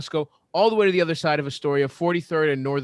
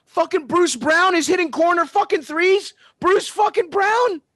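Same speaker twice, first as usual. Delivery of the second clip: furious shout; 13 semitones higher, 5 dB louder than usual. Real, not acted. Furious about opponent's threes.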